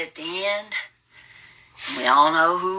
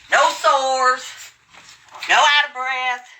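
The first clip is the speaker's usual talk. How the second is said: triumphant taunt; 9 semitones higher, slower than usual. Real, not acted. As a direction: crow the win at them. Taunting viewers after jumping jacks.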